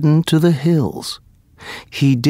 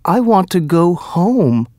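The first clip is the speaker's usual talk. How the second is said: cried; 7 semitones higher than usual. A narrator's reading. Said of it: "Aladdin cried".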